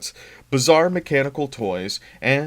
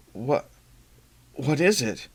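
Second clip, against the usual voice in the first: stammered; 4 semitones higher, slower than usual. Acted. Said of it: "Billy stammered".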